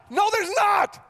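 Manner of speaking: high, loud insistent shout; exasperated dad. Retold or imitated. Dad yelling at daughter that the bug is gone. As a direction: shout back over the screaming.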